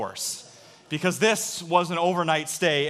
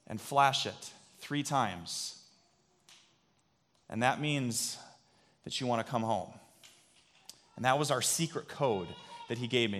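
tender reassurance; 4 semitones lower, 4 dB quieter than usual. Retold or imitated. Dad comforting scared son.

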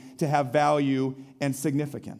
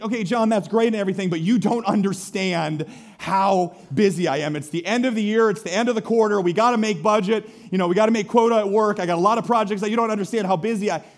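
rushed objection; 4 semitones higher, 4 dB louder, faster than usual. Retold or imitated. Listener voiced objecting, rushed.

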